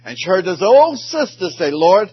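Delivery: high fervent storytelling; preacher's rising plea; impassioned preaching. Acted. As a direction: carry the story up with fervor. Preaching climax, story of Pollard.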